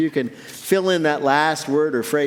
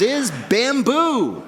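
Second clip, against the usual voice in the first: slow, higher, stretching bamboo; drawn-out reveal; 8 semitones higher, much slower than usual. Acted. Host revealing the new superfood, drawn out. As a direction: land the word bamboo slowly like a big reveal. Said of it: "punchline reveal".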